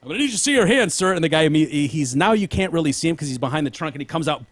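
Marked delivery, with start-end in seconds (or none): 0.0-1.1 raised, firm officer voice, higher pitched; a cop ordering a suspect; lightly voiced cop demanding hands; cop command. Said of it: "the guy's like".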